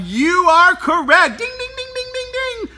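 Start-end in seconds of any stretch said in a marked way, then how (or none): 1.4-2.7 high soft game-show bell; ding ding chime. Pretend. Teacher marks the right answer with a high soft chime. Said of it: "correct answer chime".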